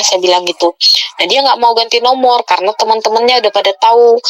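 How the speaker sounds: usual speaking voice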